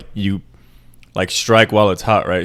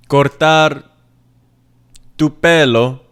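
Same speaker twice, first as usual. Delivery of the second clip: loud, higher-pitched mock Italian accent; 5 semitones higher, 4 dB louder, slower than usual. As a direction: put on a hammy Italian accent. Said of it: "said that with an Italian accent".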